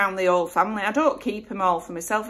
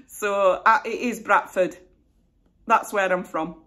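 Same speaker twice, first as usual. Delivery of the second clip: normal talking voice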